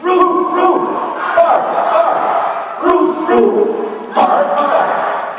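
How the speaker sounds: high, airy barking chant; rhythmic puppy bark chant; speak dog. Shown as a demonstration. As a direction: lead the kids in dog barks. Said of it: "Say it with me".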